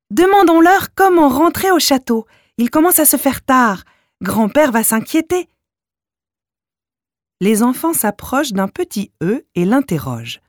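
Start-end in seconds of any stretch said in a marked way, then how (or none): 0.1-5.5 put-on child voice. A narrator's reading. Malika voiced by narrator.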